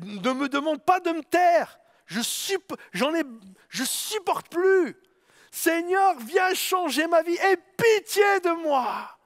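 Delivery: high shout, slowing to a drawn-out plea; desperate shout. Acted. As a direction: scream for mercy, breaking down at the end. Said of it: "il criait encore plus fort".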